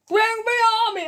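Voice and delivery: high, loud shouted command; mock battle cry. Reviewer imitating the command at the film's end, high mock shout. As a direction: shout it like a hammed-up army leader. Film character's command acted out.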